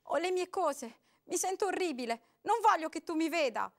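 woman making excuses, trembling. A narrator's reading. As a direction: plead with a shaking voice. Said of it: "rispose la voce tremando".

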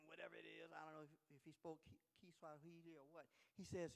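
ordinary talk, nothing marked

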